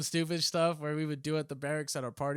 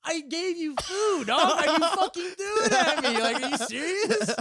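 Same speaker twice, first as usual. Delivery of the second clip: high, loud, drawn-out mock outrage; retold outraged protest; 13 semitones higher, 9 dB louder, slower than usual. Storyteller to homeless man who rejected food, mock outraged. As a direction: stretch it out in incredulous, pitched-up disbelief.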